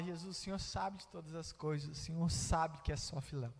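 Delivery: low, soft, subdued; saddened reply. Acted. Peter saddened by Jesus asking, low subdued reply. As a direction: answer quietly, pitch dropped, deflated. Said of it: "Pedro, entristecido por Jesus perguntar, e agora nesse tom".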